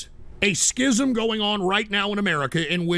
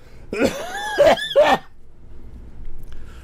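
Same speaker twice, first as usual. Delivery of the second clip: sick coughing fit. Real, not acted. Streamer ill on air, sick coughing. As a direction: cough hard in a long fit. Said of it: "See, I'm sick".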